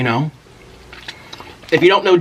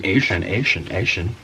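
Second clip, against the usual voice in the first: impersonating an American; 7 semitones lower, 5 dB quieter, slower than usual. Retold or imitated. Vlogger relaying how foreigners mimic Americans, mock American accent. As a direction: lightly mimic American word endings, pitched low. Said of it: "mimicking or just impersonating an American".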